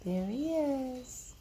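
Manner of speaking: ordinary delivery, nothing marked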